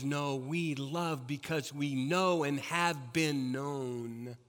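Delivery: soft, low and slow; hushed tender. Real, not acted. Preacher quoting Christianity's answer to congregation, soft and tender. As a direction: let it sink in gently.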